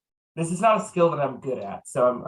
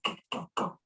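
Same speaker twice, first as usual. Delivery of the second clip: hushed urging; 4 semitones higher, 11 dB quieter than usual. Acted. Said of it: "I look at the rest of my band members like".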